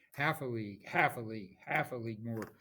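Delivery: driving beat, hard hit on "Half"; stress on first word. Shown as a demonstration. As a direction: punch HALF in each "Half a league", letting "a league" fall away. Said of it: "puts a lot of emphasis on that first word".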